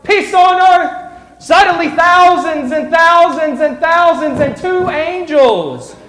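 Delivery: high, loud, slow proclaiming; joyful proclamation. Acted. Storyteller shouting the angels' praise to the room.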